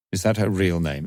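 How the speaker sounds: ordinary delivery, nothing marked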